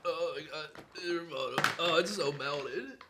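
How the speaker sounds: weak, strained with pain. Real, not acted. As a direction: groan the words out in pain. Suffering from the hot pepper.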